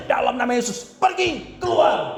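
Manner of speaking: high, insistent rebuke building to expulsion; exorcism command. Retold or imitated. Preacher reenacting driving out a demon, exorcism command. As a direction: order the spirit out, pitch climbing. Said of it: "saya mulai lagi".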